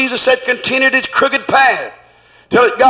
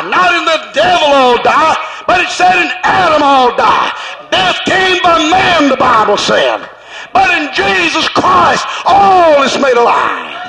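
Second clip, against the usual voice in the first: preaching at full force; 4 semitones higher than usual. Acted. Preacher thundering scripture to the congregation, full force. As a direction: build and shout it to the church.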